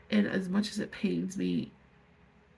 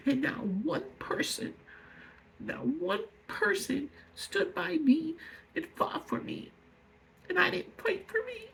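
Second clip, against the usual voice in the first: tearful; 8 semitones higher, 3 dB quieter than usual. Real, not acted. Speaker telling viewers nobody stood by them, voice breaking. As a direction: voice breaking, high and halting. Breaking down over never being defended.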